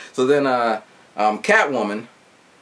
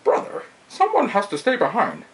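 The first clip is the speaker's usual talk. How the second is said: high, put-on masked villain voice; mock villain pronouncement; Bane's voice; 10 semitones higher than usual. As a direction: intone it like a solemn movie villain. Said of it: "Bane's like".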